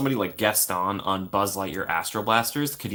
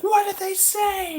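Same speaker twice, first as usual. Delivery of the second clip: high, slow, menacing queen voice; a high mock queen asking sweetly; Queen of Hearts put on high and slow; imitating the Queen; 4 dB louder, slower than usual. Queen voiced in an acted quote.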